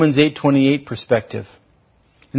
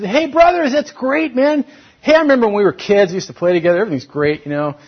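much higher, quick and breezy, glossing it over; breezy fake cheer; 7 semitones higher, 5 dB louder than usual. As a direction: act like nothing ever happened. Mock Joseph pretending all's fine.

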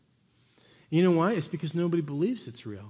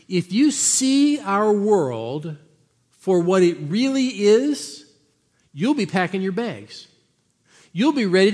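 slow, loud, rising preacher; emphatic preaching; 4 semitones higher, 6 dB louder, slower than usual. Acted. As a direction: lean into every word slowly.

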